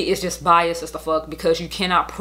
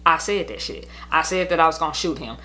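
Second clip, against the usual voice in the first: ordinary talk, nothing marked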